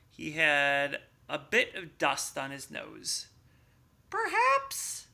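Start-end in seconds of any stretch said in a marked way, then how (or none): 4.1-5.1 little mouse voice. Acted. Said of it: "said the mouse".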